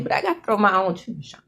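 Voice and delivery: ordinary talk, nothing marked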